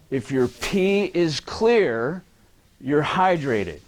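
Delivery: "If your pee is clear" drawn out slowly, rising on "clear"; slow emphatic quote. Shown as a demonstration. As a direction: spell out the common belief slowly. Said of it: "this is what I hear a lot".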